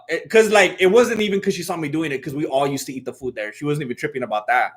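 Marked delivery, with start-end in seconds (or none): none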